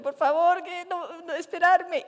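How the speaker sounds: high, loud desperate plea; pleading cry. Retold or imitated. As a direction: call after them begging them to wait. Begging chimps not to leave. (súplica desesperada, aguda y fuerte)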